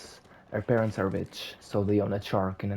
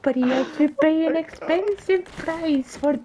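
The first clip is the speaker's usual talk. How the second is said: witch voice; 7 dB louder than usual. Acted. Witch tells Cinderella the price in a high loud screech. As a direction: play the wicked witch bargaining. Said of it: "you sound like a whole different person".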